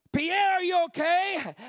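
high, loud, slow worried check; alarmed calling out. Retold or imitated. Evangelist checking on a shaking dying man, alarmed call. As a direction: call out to rouse him, acted alarm.

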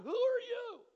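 high shout of alarm; loud high startled demand. Retold or imitated. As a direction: yell at the stranger in a panic. Workers startled by him.

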